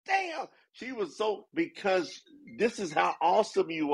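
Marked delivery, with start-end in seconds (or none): 0.1-0.5 high, excited outcry; imitating her amazed cry, pitched well up; excited exclamation. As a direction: shout it up high like she did. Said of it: "Did you hear her go".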